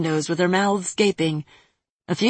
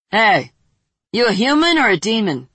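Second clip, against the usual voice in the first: loud, fast call; 5 semitones higher, 5 dB louder than usual. Acted. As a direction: holler down the alley. Chad calls to child.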